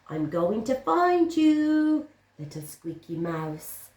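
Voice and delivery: the cat stalking its prey; menacing cat. Acted. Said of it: "said the cat".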